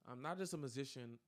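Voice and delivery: ordinary talk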